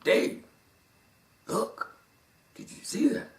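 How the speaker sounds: hushed voice in the ear; quiet inner voice. Retold or imitated. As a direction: softly call for his attention, half-whispered. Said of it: "a voice comes in my left ear".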